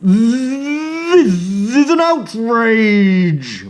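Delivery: high, slow indignant buzz; outraged bee. Acted. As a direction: huff it out like an offended bee. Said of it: "buzzed the bee".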